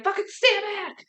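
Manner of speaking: high, desperate mock yell; imitating Jane's desperation. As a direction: bark it like she's pointing a gun. Jane threatening with the wand.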